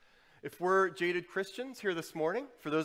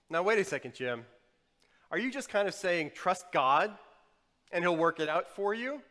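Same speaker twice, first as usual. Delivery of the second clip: loud, raised incredulous question; challenging objection; 3 semitones higher, 8 dB louder than usual. Acted. Listener challenging Jim on trusting God, challenging objection. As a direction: interrupt and question it loudly.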